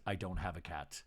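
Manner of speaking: deadpan calm reply. Retold or imitated. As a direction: say it flat and calm. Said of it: "very calmly says".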